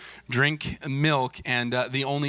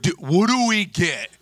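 higher, drawn-out kid impression; dopey teen boys; 6 semitones higher, 5 dB louder, slower than usual. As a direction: drag it out like an eager teenage boy. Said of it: "That's the way they sounded".

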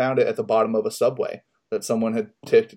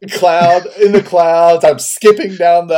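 elated; 6 semitones higher, 10 dB louder, slower than usual. Real, not acted. Joy at wallet found.

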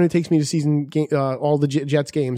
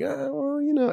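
hesitant; 11 semitones higher, 7 dB quieter than usual. Retold or imitated. Friend hesitating over the hat.

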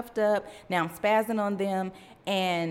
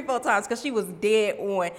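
ordinary talk

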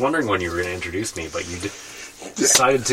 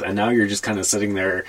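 usual speaking voice

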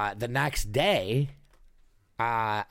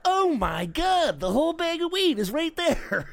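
amazed disbelief. Retold or imitated. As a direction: marvel at the dangling bag of weed. Finding the bag of weed.

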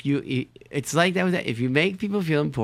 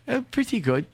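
put-on high phone voice; high-voiced airline clerk; 11 semitones higher than usual. Clerk answers the caller's small talk, high mock voice. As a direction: imitate a modest clerk in high pitch. Derek acted, high pitched.

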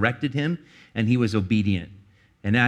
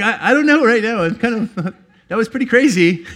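laughing; 11 semitones higher, 8 dB louder than usual. Retold or imitated. Laughing retelling of being stunned.

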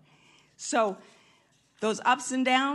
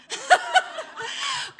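high laugh; 7 dB louder than usual. Real, not acted. Laughing at herself.

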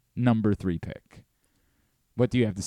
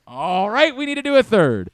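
higher and louder, fired up; excited; 10 semitones higher, 9 dB louder than usual. Real, not acted. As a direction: pump up the next round. Excited host after a lucky pick.

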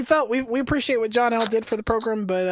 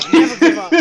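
mock-proud, breaking into a laugh, much louder and higher; laughing boast; 8 semitones higher, 13 dB louder than usual. Answering a mock interview, laughing through a loud boast. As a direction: blurt it out laughing at your own pitch.

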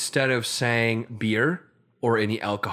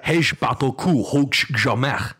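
loud, forceful mock-Klingon; Klingon phrase; 4 semitones higher, 5 dB louder than usual. Shown as a demonstration. Demonstrating a Klingon phrase for a friend, forceful alien delivery. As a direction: proclaim it like a Klingon warrior. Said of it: "I know a Klingon phrase".

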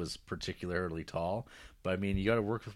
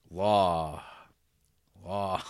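musing Lincoln impression; 3 semitones lower than usual.

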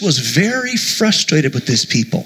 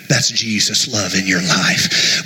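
tender slow; 8 semitones lower than usual. Real, not acted. Slow low tender line.

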